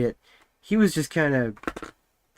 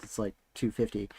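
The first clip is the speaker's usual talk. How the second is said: two fifty dropped soft and low; quiet aside; 4 semitones lower, 8 dB quieter than usual. Shown as a demonstration. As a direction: tuck the number away quietly. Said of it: "the average weight of someone is not three hundred pounds".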